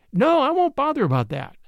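bright, high, lightly tossed off; gaily. A narrator's reading. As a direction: brush it off with a happy lilt. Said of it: "said Nevada gaily".

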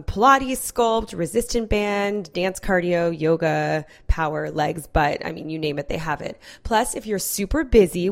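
upbeat podcast sponsor read. Acted. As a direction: sell the app like a friend. Host reads sponsor ad.

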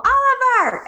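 put-on high-pitched call to a child. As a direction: call upstairs for your son, pitch jumping high. Calling to son in retold scene.

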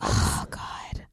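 hushed whisper; quiet at night. Real, not acted. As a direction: breathe it out softly, no voice. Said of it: "so that's why I'm very quiet".

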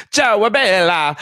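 high, drawn-out mock Italian; over-the-top Italian accent. Tourist overdoing it in Italy, exaggerated Italian accent. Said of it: "like, not".

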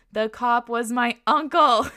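drawn-out scandalized exclamation; shocked reveal. Acted. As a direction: land the big reveal loudly, drawing it out. Reveals the story's punchline.

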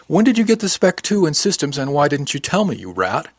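starts high, then drops onto the insult; indignant friendly scolding. A narrator's reading. Crewmate chides friend for keeping news from her, mildly indignant.

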